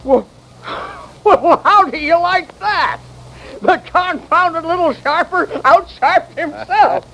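chuckling gleefully as he talks; laughing glee. Acted. Old O'Shea to the doctor, laughing with glee. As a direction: laugh through the words, delighted. Gloating over swindler's mistake.

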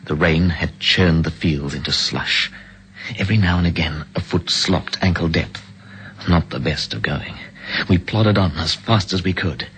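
even, measured storyteller's voice; story narration. Acted. Narrator recounting the escape from the train, in a steady storytelling voice. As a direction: tell the escape calmly, looking back on it.

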